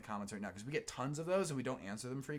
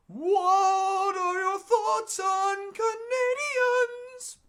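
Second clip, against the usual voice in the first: much higher, very slow and loud; squeaky reading voice; 14 dB louder, much slower than usual. Pretend. Reading a viewer's question in a silly high voice.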